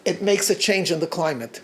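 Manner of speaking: ordinary delivery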